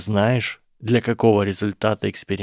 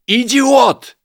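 much higher, much louder bellow; yelled insult; 8 dB louder than usual. A narrator's reading. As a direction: roar it out at full force. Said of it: "заорал Драко".

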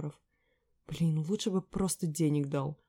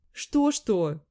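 mocking echo; 3 semitones higher, 8 dB louder than usual. A narrator's reading. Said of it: "язвит подруга".

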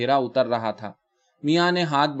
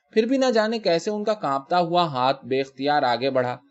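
plain reading voice

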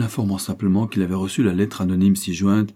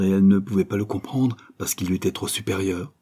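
ordinary delivery

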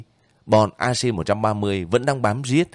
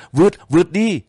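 loud, high yell of vượt; 10 semitones higher, 6 dB louder, faster than usual. A narrator's reading. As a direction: roar the order to push through. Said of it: "các xe dưới gào lên".

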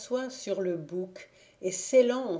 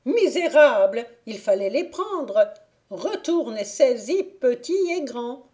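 much louder, higher enraged fairy; angry fairy berating her servant; furious scolding; 6 semitones higher, 9 dB louder than usual. Acted. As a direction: hurl insults at the squire. Fairy rages at squire.

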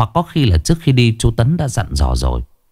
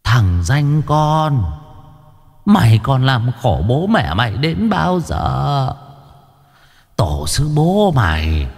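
put-on old woman scolding; 7 semitones higher than usual. A narrator's reading. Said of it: "mà quát".

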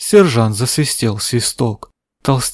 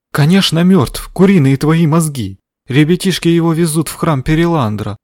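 scolding; 4 semitones higher than usual. Acted. Angry old woman scolds sergeant.

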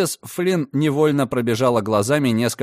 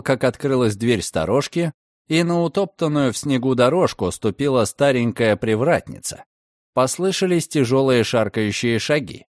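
ordinary delivery, nothing marked

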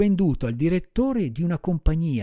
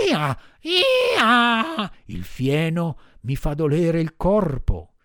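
much higher bray, then slow pleading; bray, then whine about the belly; braying donkey; 4 semitones higher, much slower than usual. Acted. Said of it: "ragliando disse".

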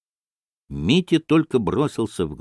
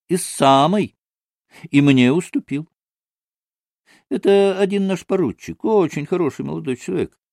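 higher, chatty, obliging explanation; ingratiating storyteller figure; 6 semitones higher than usual. Acted. Maksimov explains how he came to marry, chatty and obliging. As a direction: confide it eagerly, in a lilting pitch.

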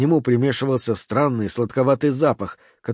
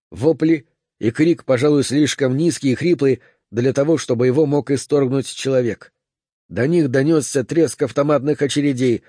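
plain reading voice

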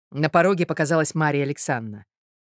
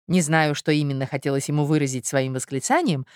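plain reading voice

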